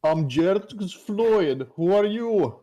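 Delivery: high, very slow drawn-out introduction; mock demand. Player character introducing himself, high and slow. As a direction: stretch out the name then demand. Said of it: "I said".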